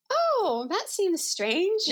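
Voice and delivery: high, slow, mildly puzzled musing; a sweetly innocent high "oh"; sweet wonder. Retold or imitated. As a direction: take it sweetly, high and unhurried. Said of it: "you just take it so sweetly".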